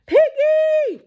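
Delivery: high loud cartoon yell. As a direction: shriek his friend's name in alarm. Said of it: "He yells".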